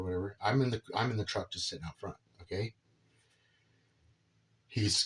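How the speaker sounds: ordinary talk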